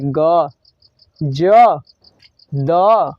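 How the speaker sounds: ग, ज, द each sustained with a tone; drawn-out voiced sounds. Shown as a demonstration. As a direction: let ग, ज, द ring on, one by one. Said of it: "इनको बोलने के लिए ना हमको लय लगता है". (ग, ज, द लय के साथ खींचकर)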